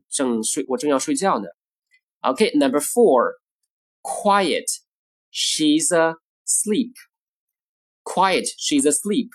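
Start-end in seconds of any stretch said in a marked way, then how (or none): 4.0-6.9 slow, spaced-out words with pauses; slow model reading. Shown as a demonstration. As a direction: present each word separately for learners. Model sentence, slow take first.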